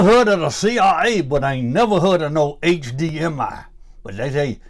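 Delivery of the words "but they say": normal talking voice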